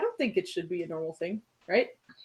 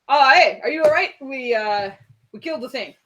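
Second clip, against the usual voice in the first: loud, high call of relief; relieved warrior; 4 semitones higher, 8 dB louder than usual. Acted. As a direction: call out glad to see them. Valen voiced in play.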